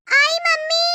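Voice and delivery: high, loud answering call; calling back. Acted. Daughter answering mother loudly, high. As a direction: shout back from across the house.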